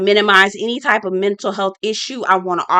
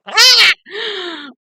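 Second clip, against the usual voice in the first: shocked shriek; 10 semitones higher than usual. Real, not acted. Shocked reaction to PK's remark.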